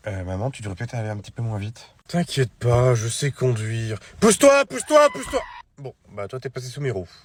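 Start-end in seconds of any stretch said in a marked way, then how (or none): 4.2-5.4 high, loud frantic yell; shouting. Acted. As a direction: yell at someone in the road. Mother speeding, yelling at pedestrian.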